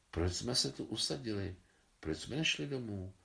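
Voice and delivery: ordinary delivery, nothing marked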